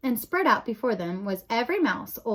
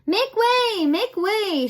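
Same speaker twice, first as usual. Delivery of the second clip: high, loud calling through a crowd; shouting; 11 semitones higher, 5 dB louder, much slower than usual. A narrator's reading. Brother pushing through the crowd, shouting. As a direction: clear the path with a shout. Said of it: "shouted Furlow".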